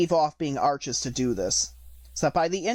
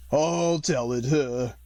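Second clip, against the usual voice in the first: deep hollow tone; much slower than usual. A narrator's reading. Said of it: "in a deep, hollow tone".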